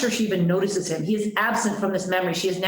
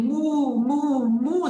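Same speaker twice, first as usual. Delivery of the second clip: high, drawn-out moo; plaintive mooing in a storyteller's voice; imitated mooing; 6 semitones higher, much slower than usual. A narrator's reading. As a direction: moo like a woman imitating a cow. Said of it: "The woman moos".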